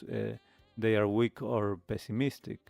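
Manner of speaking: usual speaking voice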